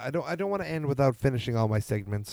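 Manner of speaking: ordinary talk, nothing marked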